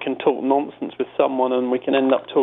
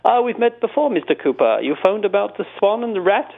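posh concierge; 7 semitones higher than usual. Retold or imitated. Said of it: "a very familiar posh voice".

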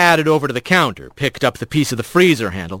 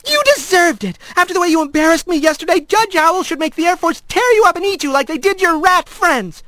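much higher, fast, furious tirade; furious outburst. A narrator's reading. Daughter screaming at Rat, fast and furious. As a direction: lash out until the voice cracks. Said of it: "Her voice cracked".

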